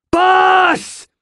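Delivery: high, drawn-out scream of a name. A narrator's reading. Jenk screaming to raging riverman in battle, shouting. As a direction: scream to be heard over the fight. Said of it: "Jenk was screaming".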